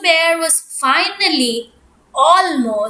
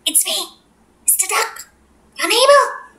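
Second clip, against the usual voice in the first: duck voice; 4 semitones higher, faster than usual. Acted. Duck voice at window.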